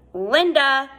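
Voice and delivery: loud call across the snow; yell. A narrator's reading. Todd calls his sister home from across the yard, yelling. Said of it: "Todd yelled".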